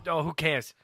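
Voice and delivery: ordinary talk, nothing marked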